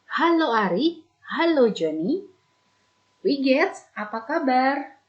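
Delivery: teacher acting the friend's greeting in a German dialogue; lively dialogue reading. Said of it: "Ibu bacakan".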